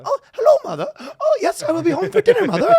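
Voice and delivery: very high, loud British schoolboy; hammed-up prim British accent. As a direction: ham up a squeaky British schoolboy to his parents. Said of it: "hard British schoolboy with the parents".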